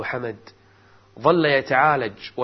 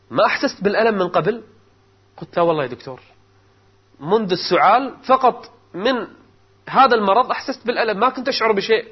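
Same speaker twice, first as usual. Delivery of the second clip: agitated; 7 semitones higher, 5 dB louder, faster than usual. Acted. Distressed patient answers the doctor.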